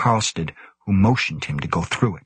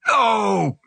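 high, strained rasping beast cry; rasping gorilla; 14 semitones higher than usual. A narrator's reading. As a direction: rasp it out as a talking gorilla. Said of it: "it said in a rasping voice".

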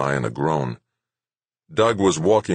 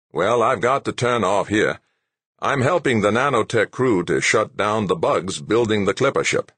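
a hint of a matter-of-fact engineer, pitched up; higher character voice, businesslike; lightly voiced practical engineer; 6 semitones higher, 3 dB louder than usual. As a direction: lightly act a colleague heading off. Narrator voices engineer signing off.

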